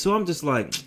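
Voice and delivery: usual speaking voice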